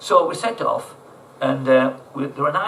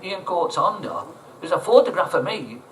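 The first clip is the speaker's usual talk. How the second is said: ordinary talk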